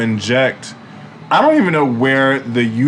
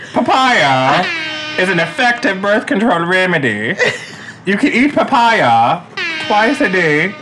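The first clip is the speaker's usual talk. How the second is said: a silly put-on Jamaican-Irish accent, pitched up; Jamaican leprechaun accent; 7 semitones higher than usual. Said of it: "You sound like a Jamaican leprechaun".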